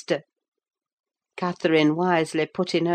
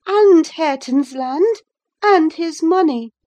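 put-on high, loud young woman goading him; taunting girl piling on accusations; reckless defiance; 10 semitones higher, 8 dB louder than usual. A narrator's reading. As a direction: press on recklessly, voice raised high. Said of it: "pursued the reckless thing".

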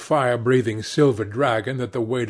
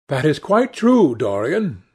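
higher, louder exclamation of agreement; approving cry; 5 semitones higher, 6 dB louder than usual. A narrator's reading. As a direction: cry out in agreement, lightly acted. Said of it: "cried Hallward".

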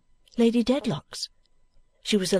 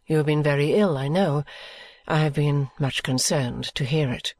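a proud lady softening her tone with care; Lady Dedlock speaking gently to Miss Summerson; Lady Dedlock, kind but composed; 5 semitones lower than usual. A narrator's reading. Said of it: "she asked kindly".

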